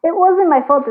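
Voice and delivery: sarcastic imitation of the ex's excuse; mocking their excuse. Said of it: "is what they're saying".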